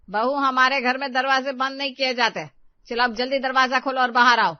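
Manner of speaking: stern mother-in-law shouting through a door; loud scolding order. Acted. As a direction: lay down the house rule at full voice.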